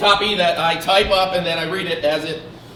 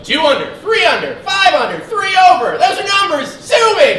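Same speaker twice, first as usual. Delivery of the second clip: much higher, loud defiant shouting; 13 semitones higher, 9 dB louder than usual. Acted. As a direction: yell the numbers in defiance.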